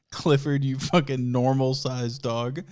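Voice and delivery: ordinary talk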